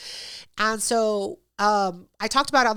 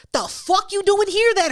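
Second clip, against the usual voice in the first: outraged shout; 10 semitones higher, 4 dB louder than usual. Retold or imitated. Confronting guest who won't drink.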